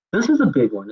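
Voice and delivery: usual speaking voice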